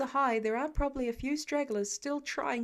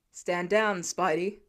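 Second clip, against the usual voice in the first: low, louder commanding fireman voice; fire chief calling; 6 semitones lower, 4 dB louder, slower than usual. A narrator's reading. Fire chief ordering Spider-Man to stand down, low and commanding. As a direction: order him off like a gruff chief. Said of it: "one of the firemen calls".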